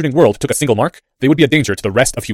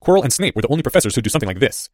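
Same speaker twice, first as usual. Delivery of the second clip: ordinary delivery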